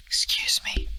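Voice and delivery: put-on whispered old lady. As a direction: lean in and whisper it. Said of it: "And then she goes".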